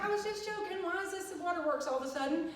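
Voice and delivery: retold bewildered protest, pitched high; mock-helpless high pitch. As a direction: protest in a high, put-upon voice. Retells own tearful protest.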